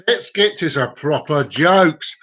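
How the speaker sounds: slow, stretched-out announcement; drawn-out announcement. Pretend. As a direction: draw out each word for show. Same line repeated in mock emphasis.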